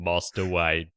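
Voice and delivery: low Cockney butler impression; Michael Caine. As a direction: address the master as a low-voiced London butler. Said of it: "like if you took Michael".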